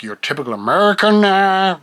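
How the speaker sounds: bad American accent. Shown as a demonstration. Showing what he does not sound like, mock American. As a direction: fake an American accent, poorly. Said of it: "I'm horrible, by the way, with American accents".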